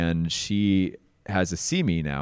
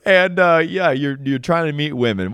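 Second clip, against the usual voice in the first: amused; 8 semitones higher, 6 dB louder than usual. Real, not acted. Still amused after laugh.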